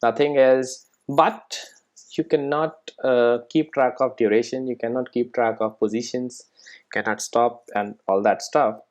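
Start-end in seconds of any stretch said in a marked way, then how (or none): none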